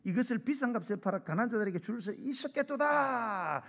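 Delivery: indignant scolding in a put-on voice; Judas rebuking. Judas rebukes the woman for wasting perfume, indignant scolding. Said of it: "뭐라고?".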